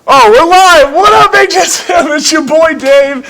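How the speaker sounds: high, loud hype-man shout; hyped greeting. Real, not acted. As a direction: pump up the crowd as the stream goes live. Livestream opener, hyped greeting.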